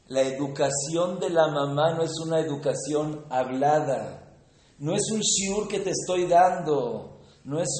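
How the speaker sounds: loud, high, insistent sermon voice; forceful preaching. Real, not acted. Preacher stresses a mother's teaching.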